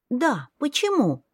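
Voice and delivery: put-on high child voice asking why; curious little girl. A narrator's reading. Little girl keeps asking her brother why. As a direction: wonder aloud like a small child.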